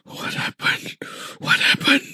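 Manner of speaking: voiceless, panting fright; frightened gasping. Acted. Storyteller acting the terrified man holding the camera, frightened gasping. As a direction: gasp it out, short of breath with fear. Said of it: "dengan nafasnya tercungap-cungap macam takutlah".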